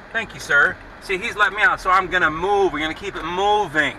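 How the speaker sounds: loud call. Real, not acted. Calling out thanks to officer.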